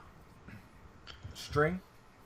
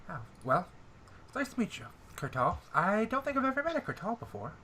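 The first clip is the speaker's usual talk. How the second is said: nasal NPC voice; 8 semitones higher, faster than usual. Acted.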